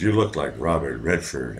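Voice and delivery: low, soft imitation of her flattery; low-pitched mock sweet-talk; retold flattery. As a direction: murmur her compliment way down low.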